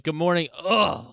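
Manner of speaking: exaggerated good morning collapsing into a groan; mocking co-host's groggy greeting. Co-host imitating partner coming on air, mocking. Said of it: "you come on and you're like".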